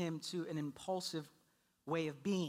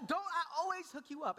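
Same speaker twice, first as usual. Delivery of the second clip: high-pitched appeal over the crying; exasperated parent voice; pleading dad; 10 semitones higher than usual. Retold or imitated. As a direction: try to settle a screaming baby, voice pitched up. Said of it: "I say that a few times".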